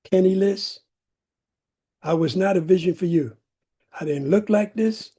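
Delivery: ordinary delivery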